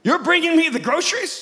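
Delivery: surprised yell. Retold or imitated. Catching the grocery giver, a surprised shout. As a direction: call out his name in shock. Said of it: "I jumped out and I yelled".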